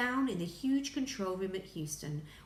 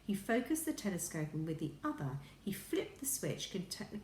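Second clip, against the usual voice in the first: plain reading voice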